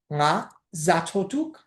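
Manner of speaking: slow, syllable by syllable γα ζα το τουκ; careful slow Tibetan model phrase; slowed teaching demo. Shown as a demonstration. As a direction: spell out γα ζα το τουκ slowly for learners. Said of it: "Ζα είναι το ρήμα τρώω".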